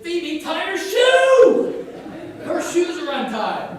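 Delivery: high, very loud, drawn-out mock shout; retold criticizing yell. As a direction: bellow a comic complaint across the track. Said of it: "the first thing I did was criticize her".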